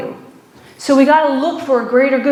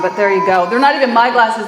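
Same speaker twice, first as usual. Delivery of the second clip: ordinary talk, nothing marked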